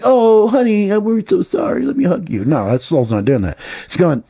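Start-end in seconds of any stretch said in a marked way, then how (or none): 0.0-2.4 much higher, sugary mock consoling; a doting soul mocked as it soothes; mock coddling soul. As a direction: fake sympathy to a crybaby. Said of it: "soul's like".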